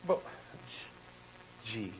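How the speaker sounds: normal talking voice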